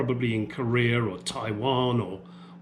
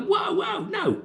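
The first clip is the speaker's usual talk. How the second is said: mock high yelp; slower than usual. Mock reaction to agent.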